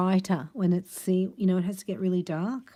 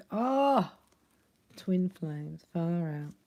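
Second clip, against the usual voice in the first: usual speaking voice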